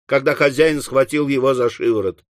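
ordinary delivery, nothing marked

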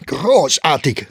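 loud, high, drawn-out exclamation; sarcastic shout. A narrator's reading. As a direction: call it out slowly in mock praise. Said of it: "rief Staufner". (laut gedehnter, höhnischer Ausruf)

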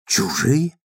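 чужие asked with a rising, stunned tune; disbelieving question. A narrator's reading. D'Urberville repeating Tess's word, stunned question. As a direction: echo чужие as if you can't believe it. He echoes her cold word.

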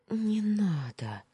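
slow, high, breathless whisper; hushed high whispered plea. A narrator's reading. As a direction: plead in a trembling whisper. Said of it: "прошептала я".

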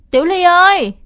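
loud, high call across the yard. Acted. Boy calls his sister from across the porch, loud call. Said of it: "gọi giọng sang".

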